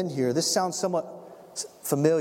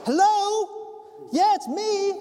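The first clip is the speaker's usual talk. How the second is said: high, louder voice calling through a gate; calling at the door; 3 dB louder, much slower than usual. Acted. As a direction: call out from outside. Peter knocking at the gate.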